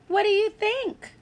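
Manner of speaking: usual speaking voice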